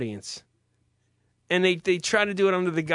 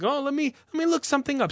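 light mock voice; 10 semitones higher, faster than usual.

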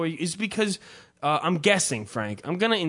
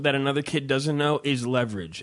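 ordinary talk, nothing marked

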